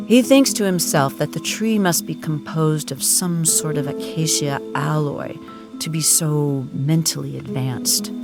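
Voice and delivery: usual speaking voice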